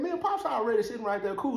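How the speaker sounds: ordinary delivery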